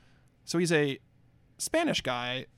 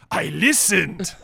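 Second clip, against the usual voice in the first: slow, loud mock Spanish accent; hammed-up Spanish-accented movie star; Antonio Banderas; 3 semitones higher, 7 dB louder, much slower than usual. Shown as a demonstration. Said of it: "in Antonio Banderas' voice".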